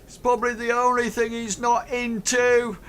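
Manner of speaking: very high calling voice; shouted heckle. Acted. Somebody topping the joke about Hank, high shouted heckle. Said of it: "somebody shouts".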